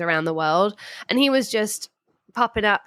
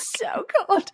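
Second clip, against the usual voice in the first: laughing echo; 13 semitones higher than usual. Retold or imitated. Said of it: "I felt like Titanic".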